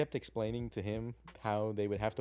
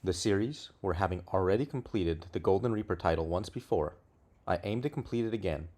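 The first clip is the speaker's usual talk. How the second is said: loud, upbeat series introduction; hyped video intro; 8 dB louder than usual. Acted. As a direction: announce the series like a host.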